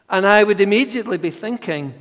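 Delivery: loud, fast build; excited preaching. Acted. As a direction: push it out with urgency. Preaching at full force.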